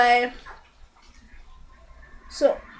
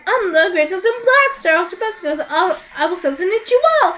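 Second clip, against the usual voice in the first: high, loud, hammed-up boasting; 6 semitones higher, 4 dB louder, faster than usual. Egotistic assassin introducing himself to everyone, hammed-up bragging. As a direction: crow it like a cocky ninja. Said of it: "the egotistic ninja assassin".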